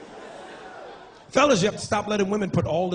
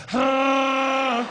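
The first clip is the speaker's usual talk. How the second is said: Chewbacca grunt; 6 semitones higher than usual. Retold or imitated.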